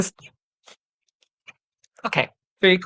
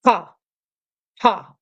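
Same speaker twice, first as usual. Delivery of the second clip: belly ha; 5 semitones higher than usual. Shown as a demonstration. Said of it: "you're going in and up".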